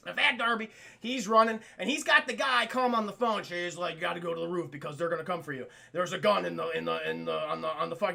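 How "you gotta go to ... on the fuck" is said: fast, low urgent instructions; hurried caller. Acted. Caller rushing Darby to the roof, fast low urgent voice. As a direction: rattle off the directions.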